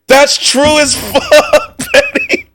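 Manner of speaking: high squeaky laugh through the words. Real, not acted. Streamer cracking up at a friend's joke, laughing through words. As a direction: crack up mid-line.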